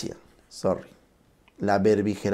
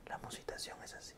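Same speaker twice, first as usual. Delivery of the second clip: soft unvoiced whisper demonstrating low volume; 3 semitones lower, 23 dB quieter, faster than usual. Shown as a demonstration. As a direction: whisper it as if telling a secret. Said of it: "cuando hablas en bajo volumen".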